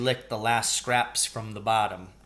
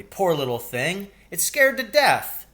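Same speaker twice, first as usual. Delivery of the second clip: put-on higher, louder pitying boy voice; 7 semitones higher, 5 dB louder than usual. A narrator's reading. Paul telling Billy the mouse is frightened, pitying. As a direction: feel sorry for the mouse.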